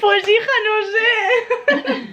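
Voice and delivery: high, giggling reply; laughing. Real, not acted. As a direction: laugh through it. Laughing at Spanish question.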